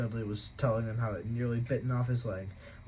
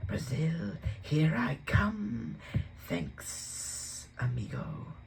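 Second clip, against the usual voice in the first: whispered, slow snake hiss; 5 semitones higher, much slower than usual. Acted. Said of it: "a low hissing voice had said".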